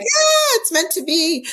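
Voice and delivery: very high, loud mock squeal; imitated burst of excitement, shooting high; delighted squeal. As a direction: squeal it like an overjoyed friend. Acted partner's delighted reaction.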